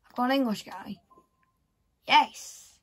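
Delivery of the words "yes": real, loud cheer; excited. Real, not acted. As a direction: burst out with a short, excited shout. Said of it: "I'm getting excited over S B Cs".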